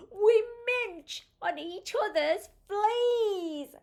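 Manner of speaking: high, drawn-out comic delivery; high, stretched storybook glee; gleeful punchline. A narrator's reading. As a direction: relish it slowly for small listeners. Comic punchline of chimpanzee rhyme.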